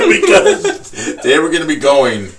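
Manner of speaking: big high laugh into words. Real, not acted. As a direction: burst out laughing mid-sentence. Laugh at the joke.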